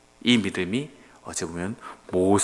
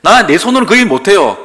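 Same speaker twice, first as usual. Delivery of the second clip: loud, higher whining excuse; pleading excuse; 8 semitones higher, 12 dB louder than usual. Retold or imitated. Moses whines to God, loud and high. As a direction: complain that you can't. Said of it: "하나님께서 말씀하시죠".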